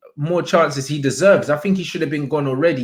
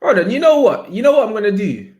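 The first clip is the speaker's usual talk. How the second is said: a burst of sudden excitement, pitched up; excited sudden idea; 6 semitones higher, 3 dB louder than usual. Real, not acted. Streamer to viewers, suddenly excited. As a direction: cut yourself off as an idea hits.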